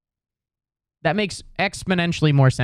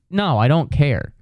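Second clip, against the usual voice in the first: ordinary delivery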